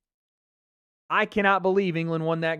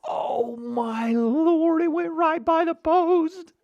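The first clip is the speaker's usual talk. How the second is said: disbelief shout; 10 semitones higher, slower than usual. Real, not acted. Ball just missed the post.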